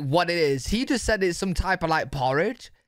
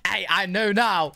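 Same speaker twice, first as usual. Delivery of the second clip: usual speaking voice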